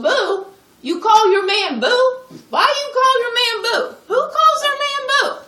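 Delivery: can't believe what she just heard, voice cracking up high; incredulous outrage. Acted. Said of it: "I go".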